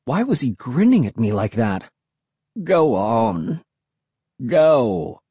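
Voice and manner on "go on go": drawn-out hermit murmur; low, lazy urging from the hermit. A narrator's reading. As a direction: murmur it low, stretching the words. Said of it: "he murmured".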